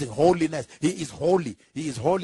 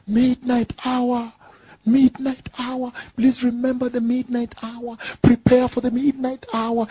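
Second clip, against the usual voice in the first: high, sustained preacher's cry; insistent ringing proclamation; calling out; 5 semitones higher than usual. Acted. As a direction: call it out slowly like a herald, pitched high. Preacher voices God's repeated call.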